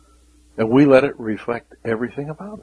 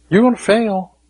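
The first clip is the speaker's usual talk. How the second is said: stern warning; 8 semitones higher, 4 dB louder than usual. Real, not acted. Preacher warning.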